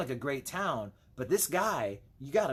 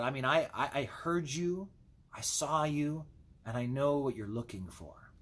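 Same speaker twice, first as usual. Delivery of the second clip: ordinary talk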